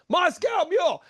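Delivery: high shouted drink order; shouted down the line. Retold or imitated. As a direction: call it out across a packed bar. Order shouted down packed crowd.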